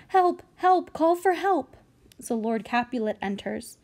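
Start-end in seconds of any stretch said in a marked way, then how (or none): 0.1-1.8 shouting for help. Acted. Lady Capulet calls for help.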